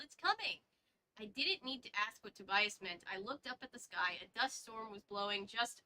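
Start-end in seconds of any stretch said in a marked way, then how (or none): 0.0-0.6 high alarmed warning; urgent warning from the trees; yell. Acted. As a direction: call it out, worried. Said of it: "Tobias yelled".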